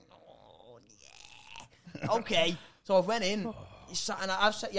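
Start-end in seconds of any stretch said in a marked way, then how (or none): 0.1-2.1 hesitant whisper. Retold or imitated. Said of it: "And I was like".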